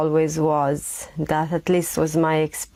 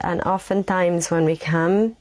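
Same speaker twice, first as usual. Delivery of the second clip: normal talking voice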